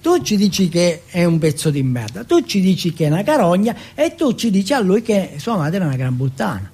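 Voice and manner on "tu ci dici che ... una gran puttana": plain reading voice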